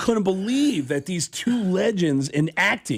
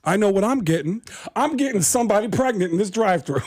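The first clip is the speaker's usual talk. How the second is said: higher, brash Tracy Morgan bragging; Tracy Morgan impression; 7 semitones higher than usual. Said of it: "Tracy Morgan".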